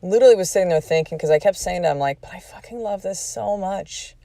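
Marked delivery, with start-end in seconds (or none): 2.2-4.1 quiet, choked-up retelling through tears; soft tearful plea, almost breaking. Retold or imitated. To husband Ed after sparring, quiet and tearful. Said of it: "cried a lot".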